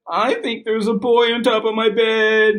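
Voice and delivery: high little monster, worried. Acted. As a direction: squeak it up like a scared little monster.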